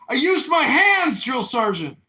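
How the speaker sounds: high, loud acted military reply; shouted recruit report. Recruit reports to his drill sergeant, shouting high. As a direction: yell it like an eager recruit.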